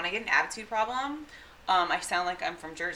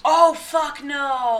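shocked; 7 semitones higher, 8 dB louder, much slower than usual. Real, not acted. Reacts to the KKK history.

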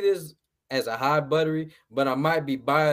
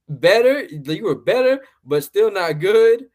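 louder, half-impressed, still unconvinced; grudging verdict; 4 semitones higher, 7 dB louder, slower than usual. Retold or imitated. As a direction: give the grudging compliment, then the put-down. Grudging verdict on actor's performance.

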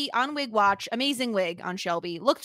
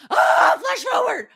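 loud, high, thrilled cry; excited shout; 11 semitones higher, 6 dB louder, slower than usual. Retold or imitated. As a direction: shout it with delight. Hyped self quote.

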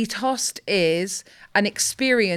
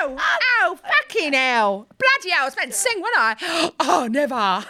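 high, brash cockney exclamations as [name]; [name] impression; 8 semitones higher than usual. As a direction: play a hammed-up, swearing [name], pitched way up. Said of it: "can you do an [name] impression?".

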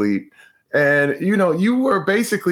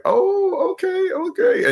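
sheepish okay; slower than usual. Retold or imitated. Retold meek reply to friend.

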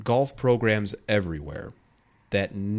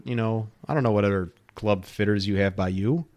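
ordinary talk, nothing marked

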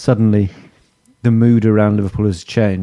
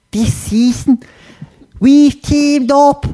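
high-pitched, drawn-out mock Scouse; hammed-up Liverpudlian ad read; attempted Scouse accent; slower than usual. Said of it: "I'm gonna do it in Scouse".

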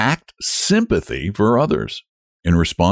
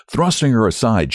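ordinary delivery, nothing marked

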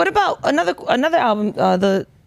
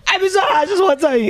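higher, tipsy slurred toast; drunk uncle voice; 7 semitones higher than usual. Retold or imitated. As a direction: play the rambling drunk uncle at a party. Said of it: "He sounded like the drunk uncle".

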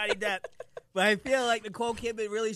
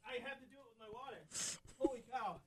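quiet pained; 5 semitones higher, 11 dB quieter than usual. Real, not acted. Pepper burning his eyes.

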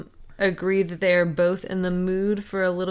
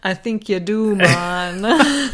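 put-on Canadian accent; 5 dB louder, much slower than usual. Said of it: "Canadian accent".

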